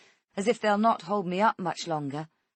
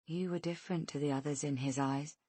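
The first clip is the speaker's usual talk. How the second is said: softly; 2 semitones lower, 7 dB quieter than usual. Acted. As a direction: say it as a quiet confession. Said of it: "Rhonda says softly".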